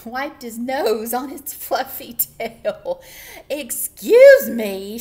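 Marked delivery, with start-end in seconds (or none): 3.5-5.0 loud, drawn-out shout from the rabbit; outraged storybook bellow; indignant shout. A narrator's reading. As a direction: shout it slowly, stretching the middle. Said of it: "shouted the rabbit".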